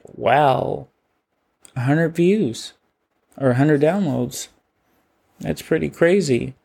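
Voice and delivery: wondering recalled reaction, lilting up on key words; mildly amazed. Retold or imitated. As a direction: marvel lightly at the news. Recalls reaction to first email.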